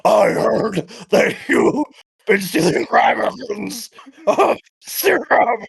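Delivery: slow, strained raspy politician; RFK Junior voice. Acted. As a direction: drag each word out slowly. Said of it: "The skeleton talks like R F K Junior".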